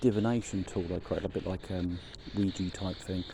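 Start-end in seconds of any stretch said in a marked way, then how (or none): none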